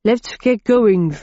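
slow, muffled by a full mouth. Acted. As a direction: chew while speaking. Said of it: "with my mouth full".

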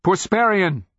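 high, loud shout of a name; urgent falling yell; calling out. A narrator's reading. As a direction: call after someone walking away. Calling after man who ignores him.

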